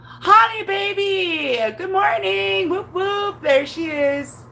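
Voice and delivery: high, drawn-out happy call; excited greeting. Real, not acted. Greeting Honey as she turns up, excited call. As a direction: sing out a delighted hello.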